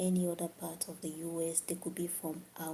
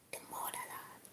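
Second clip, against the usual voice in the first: dramatic whisper; 9 dB quieter than usual. Real, not acted. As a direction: breathe the motherland like a secret. Proud dramatic homeland declaration.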